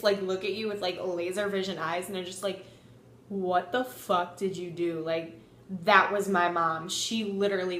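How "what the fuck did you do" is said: low, restrained mom voice; disapproving mom. Retold or imitated. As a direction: imitate a mom's laser-glare question, pitched down. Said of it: "you know that mom look".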